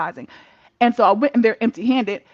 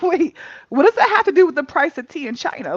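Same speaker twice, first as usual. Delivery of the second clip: incredulous; 5 semitones higher, 5 dB louder than usual. Retold or imitated. Retold indignant reply to therapist.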